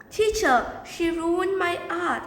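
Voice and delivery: high, drawn-out appeal to teacher; acted pupil's grievance; tattling complaint.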